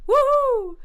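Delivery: very high, loud joyful squeal; high-pitched squeal. A narrator's reading. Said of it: "made high-pitched noises like".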